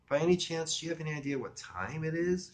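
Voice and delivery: high female voice with a hint of English; affected English accent. Woman asks caller the time, affected English accent. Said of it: "She was getting an English accent".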